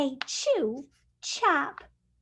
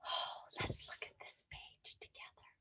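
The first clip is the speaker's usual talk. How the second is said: soft quick whisper; 21 dB quieter, faster than usual. Real, not acted. Teacher whispering to her class, soft whisper. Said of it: "whispered aside to children".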